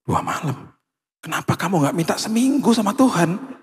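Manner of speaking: husband teasing wife about her prayer, incredulous; joking disbelief. Retold or imitated. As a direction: tease in mock disbelief. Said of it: "pas saya dengar gitu".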